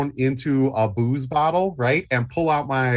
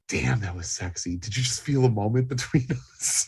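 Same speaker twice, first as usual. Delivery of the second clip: low, soft smooth-talker; seductive murmur; 4 semitones lower, 5 dB quieter than usual. Acted. Luther flirting with the woman who stopped him, low soft seduction. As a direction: purr it like a cheesy lover. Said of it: "and I'm like".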